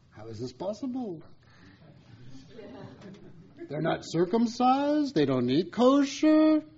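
high Yiddish accent, lightly put on.

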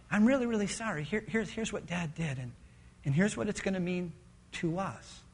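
soft, low, halting apology; remorseful confession. Acted. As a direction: break hard news to the children quietly, with pauses. Father confessing to his children.

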